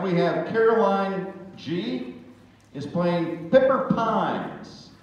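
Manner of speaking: slow, loud, high announcement; announcer call. Real, not acted. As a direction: draw out the introduction. Announcing next contestant.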